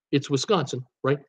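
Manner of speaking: ordinary talk, nothing marked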